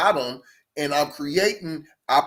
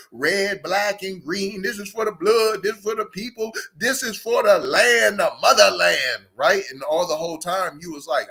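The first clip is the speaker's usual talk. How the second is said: normal talking voice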